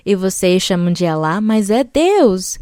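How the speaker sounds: ordinary talk, nothing marked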